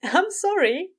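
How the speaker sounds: high, loud, barely hidden amusement; amused apology. A narrator's reading. Aziraphale apologises to overworked Crowley, audibly amused. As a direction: apologise while fighting amusement. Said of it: "unable to keep the amusement out".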